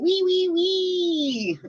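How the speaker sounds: very high, slow piggy squeal; little pig squeal. Retold or imitated. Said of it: "that little pig going".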